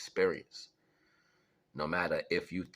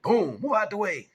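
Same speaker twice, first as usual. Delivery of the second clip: explosive high, loud boom and warning; warning shout; 9 dB louder than usual. Retold or imitated. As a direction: shout a clear-the-way as the tree falls. Acting out a falling tree.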